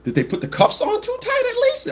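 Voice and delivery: very high mocking question; squeaky mock question. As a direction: squeak it sarcastically.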